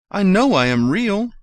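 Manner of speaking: high, loud desperate cry; desperate call. A narrator's reading. Toy rabbit crying after the rabbits, loud and high. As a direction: cry out to be believed.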